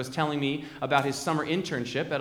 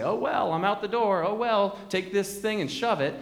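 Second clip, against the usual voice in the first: careless, higher shrug-off; passive shrug; 6 semitones higher than usual. Retold or imitated. Disciple shrugging off the church, higher and careless. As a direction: toss it off like you don't care. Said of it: "passively say".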